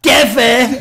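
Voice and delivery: loud, high mock-triumphant shout; imitated sarcastic outcry; sarcastic cheer. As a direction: yell the nickname in sarcastic hype. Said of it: "would just really sarcastically go".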